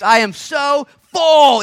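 slow, loud and high, every word stretched; joyful shout. Real, not acted. Preacher shouting his joy to the congregation, slow and loud. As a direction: cry it out with full joy. Preacher exclaiming fullness in Christ.

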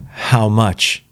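barely shaped, dry and strained; words forced through dry lips in horror; dry-lipped. A narrator's reading. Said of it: "His dry lips shaped the words".

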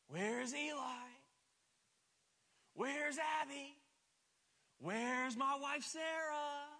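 high, slow sing-song calling; playful calling out. Pretend. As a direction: call out like you can't find them. Dad playing hide and seek.

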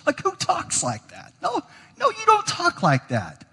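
high-pitched, laughing disbelief; incredulous. Real, not acted. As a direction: scoff at the absurd claim, pitch jumping up. Scoffing at such a claim.

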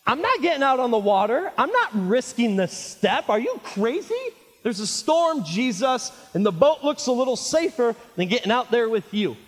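high, loud, incredulous protest; balking at Jesus over the storm; frightened disciple. Acted. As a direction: refuse in a loud, high-pitched panic. Acts out scared disciple refusing Jesus.

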